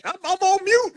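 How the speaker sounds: high-pitched parody of a lame excuse; mock excuse voice. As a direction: squeak out a fake excuse. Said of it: "you ain't muted. Don't play that".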